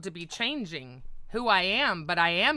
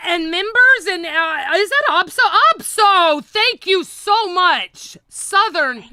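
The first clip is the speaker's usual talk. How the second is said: much louder and higher gushing; excited thanks; 10 semitones higher, 13 dB louder than usual. Real, not acted. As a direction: yell thanks to the donors.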